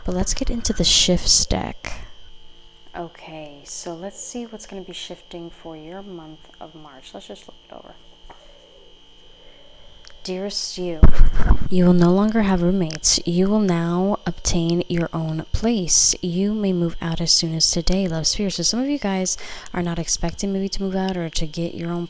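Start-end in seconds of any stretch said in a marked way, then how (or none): 3.0-7.9 much softer, whispered to herself; muttered aside. Real, not acted. Reader mumbling to herself while looking over the cards, whispered. 10.2-18.5 louder, declaiming the card's words; card message read. Acted. Tarot reader reading a card aloud to viewers, louder and declaiming.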